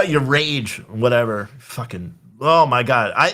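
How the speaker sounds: usual speaking voice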